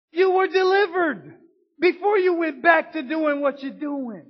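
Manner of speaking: preaching build. Acted. Preacher urging the sick to remember healing, high and loud. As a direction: lift them up with fire.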